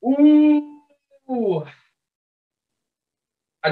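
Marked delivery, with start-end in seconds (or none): 0.0-1.6 long, high, drawn-out "ooh"; hesitant "ooh". Real, not acted. Fan put on the spot for a score prediction, hesitant. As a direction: stretch out a high "ooh" while weighing it.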